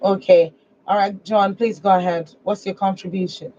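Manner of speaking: ordinary delivery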